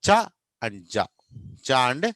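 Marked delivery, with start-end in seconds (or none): 0.0-1.0 చ as a short "cha", జ as a short "ja"; teacher modelling చ and జ separately; affricate demonstration. Shown as a demonstration. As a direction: isolate చ and జ, one at a time. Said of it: "అవి ఏంటంటే".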